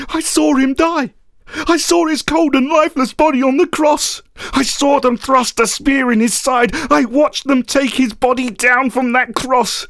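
anguished outburst, pitched high; grief-fuelled, high-voiced anger; filling with anger. A narrator's reading. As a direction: let the words flood out, high and angry. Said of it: "filling with anger, words flooded out".

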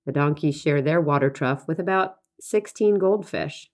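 ordinary talk, nothing marked

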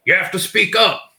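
high, loud demand, imitating a patient; irritated patient. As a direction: mimic a patient barking at the assistant. Irate patient snapping at assistant.

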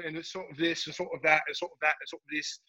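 lightly voiced proper British patter; a hint of British sort of this and sort of that; British accent. Telemarketer chatting up a caller, light British accent. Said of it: "I'd be just talking like".

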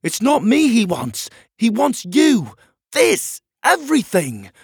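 high, loud, desperate shouting; shouted warning. Acted. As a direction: yell it back as you are dragged away. Prisoner shouts warning while dragged off.

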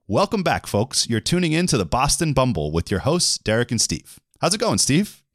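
hammed-up host read; scripted host welcome. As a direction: greet listeners like a show host. Reads scripted podcast host part.

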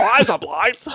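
high mock-Australian caricature; exaggerated Australian accent. Co-host parodying what Americans hear, exaggerated Australian accent. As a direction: send up the broad Australian vowels. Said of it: "that's what they think the Austra".

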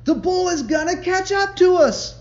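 very high, very loud desperate cry; frantic warning. A narrator's reading. Companion warning John about the bull, frantic. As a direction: yell it over your shoulder. Companion urging during chase.

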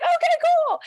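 very high, loud squeaky okay; giddy reply. Retold or imitated. Daughter answering her overjoyed parents, very high and loud. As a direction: squeak it out in a comic high pitch.

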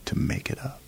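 hushed secret whisper; teacher whispering the fine print. Retold or imitated. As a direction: let the catch slip out under the breath.

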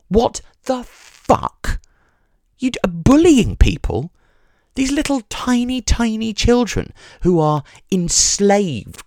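high-pitched, slow disbelieving rant; incredulous outrage. Real, not acted. Ranting about tennis parents bullying their children, high and outraged.

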